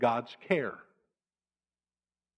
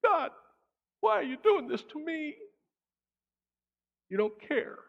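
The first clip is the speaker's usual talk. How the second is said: put-on high, childish whining at God; a believer whining at God like a kid; whiny complaint; 13 semitones higher, 3 dB quieter than usual. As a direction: whine at God like a sulking child.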